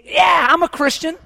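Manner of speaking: loud, high mock claim of faith; mock boastful shout. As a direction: shout it like a smug churchgoer. Mocking a shallow believer's claim.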